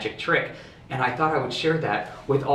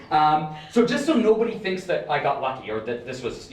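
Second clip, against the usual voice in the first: ordinary delivery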